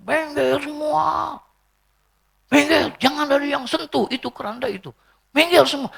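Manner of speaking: high-pitched cry, words stretched out; mock distraught yell. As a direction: imitate a hysterical mourner ordering everyone away. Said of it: "teriak dia". (teriakan histeris tiruan, nada tinggi)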